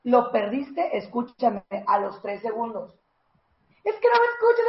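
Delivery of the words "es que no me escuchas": shrill high-pitched nagging plea; very high whiny voice; high whiny complaint. Shown as a demonstration. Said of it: "baja el tono, baja el pitch".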